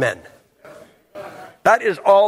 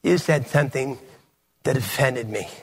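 softer, prissy mock complaint; mock offended man; 4 semitones lower, 5 dB quieter than usual. Preacher mocking a man who takes offence, soft mock whine. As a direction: imitate a touchy man, softly. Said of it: "a man walks up and goes".

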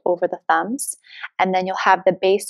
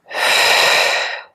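long, audible breath out through pursed lips; mouth exhale; 3 dB louder than usual. Shown as a demonstration. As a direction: blow the air out steadily. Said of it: "exhale out through the mouth with pursed lips".

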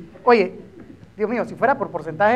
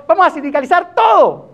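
high, climactic end of a list; exaggerated fed-up outburst; mock exasperation; 7 semitones higher, 6 dB louder than usual. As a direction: throw up the hands at the absurdity.

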